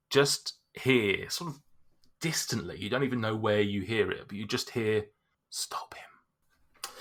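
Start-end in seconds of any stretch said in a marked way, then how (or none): 5.5-6.9 distant ghostly whisper; eerie whisper. Acted. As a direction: whisper it from far away. Distant voice heard in head.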